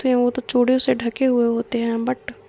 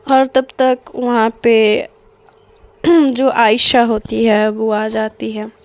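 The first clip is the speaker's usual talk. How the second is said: ordinary delivery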